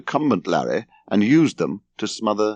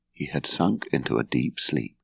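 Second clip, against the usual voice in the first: plain reading voice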